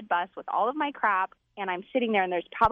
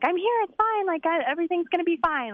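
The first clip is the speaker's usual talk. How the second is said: higher, chirpy "all fine" voice; breezy reassurance; 7 semitones higher than usual. Retold or imitated. Telling worried parents everything's fine, breezy and chirpy. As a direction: play it light and carefree.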